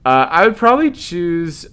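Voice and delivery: laughing loud and high; amused, half laughing through the line. Acted. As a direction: Vera cracking up at the absurd situation. Vera laughing after joke.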